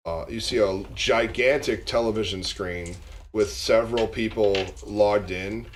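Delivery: ordinary delivery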